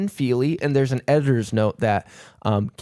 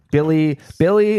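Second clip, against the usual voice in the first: put-on old-timey voice; 3 semitones higher, 4 dB louder than usual. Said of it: "We do that voice a lot".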